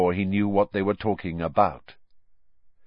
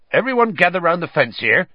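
fast, loud and high call; 8 semitones higher, 6 dB louder, faster than usual. A narrator's reading. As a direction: round them up with a shout. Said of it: "he called".

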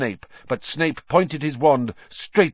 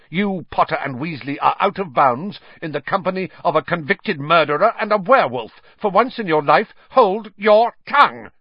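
high, loud tirade building to fury; spitting anger; 9 semitones higher, 6 dB louder than usual. A narrator's reading. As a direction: scold furiously, climbing to a near-shout. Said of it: "Snape spat".

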